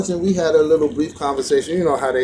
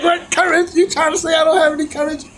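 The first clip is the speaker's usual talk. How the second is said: high, fast, offended shout; indignant outburst; 12 semitones higher, faster than usual. Retold or imitated. Speaker retorting to the sly monk, offended and shouting. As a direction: take real offense at being called a coward. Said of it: "I was like".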